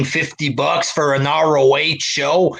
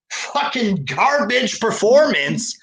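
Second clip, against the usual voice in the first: usual speaking voice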